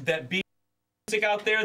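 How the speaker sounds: normal talking voice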